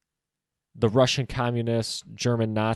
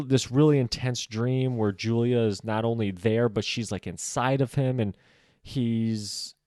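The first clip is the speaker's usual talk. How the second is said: usual speaking voice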